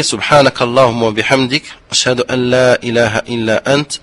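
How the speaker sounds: quiet murmured supplication; closing prayer. Acted. Teacher ends class with a quiet prayer. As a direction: close the lesson with a soft prayer.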